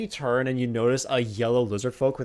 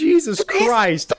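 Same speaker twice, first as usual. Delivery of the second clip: exasperated exclamation; 8 semitones higher, 7 dB louder, slower than usual. Real, not acted. GM reacting to the table.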